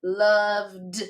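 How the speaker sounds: Loved said slowly with a clear final d; teacher modelling the d ending in Loved; sounding out ending. Shown as a demonstration. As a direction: stretch Loved so the d ending is heard. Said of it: "we hear id, we hear d".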